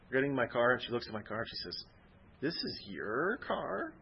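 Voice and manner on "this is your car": retold surprise. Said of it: "She said it just like that".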